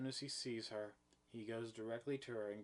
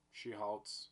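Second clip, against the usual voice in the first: usual speaking voice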